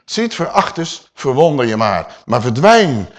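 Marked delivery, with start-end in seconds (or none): none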